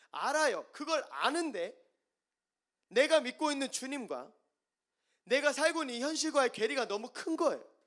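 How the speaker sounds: high, loud, urgent preaching; impassioned preaching. Acted. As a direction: press the point with passion. Preaching at full force. (높고 크게 호소하는 설교)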